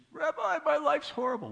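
high-pitched, whining lament to the rabbi; wailing complainer. Retold or imitated. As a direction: pile on the misery in a high whine. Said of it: "he says".

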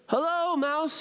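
high, calling-out voice without real volume; yelled greeting. A narrator's reading. Rabbit calls down the hole to mouse, mild yell. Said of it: "he yelled".

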